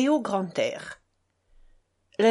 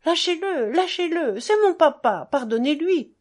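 high pleading shout; boy shouting; 7 semitones higher, 4 dB louder than usual. Retold or imitated. Son to father's attacker, high pleading shout. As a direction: beg the attacker to let go. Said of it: "crie à mon agresseur".

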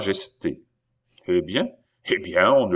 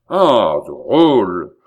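loud, raised-pitch insult; angry outburst; 10 dB louder, much slower than usual. A narrator's reading. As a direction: burst out at the rascal, full voice. Innkeeper and viscount quarrel. (éclat de colère à pleine voix)

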